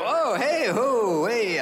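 high-pitched string of exclamations; mock startled. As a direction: act caught out by the scale. Acts startled by the scale.

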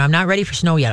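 ordinary talk

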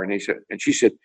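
usual speaking voice

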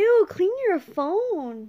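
grossed out. Real, not acted. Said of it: "It's dirty, I know".

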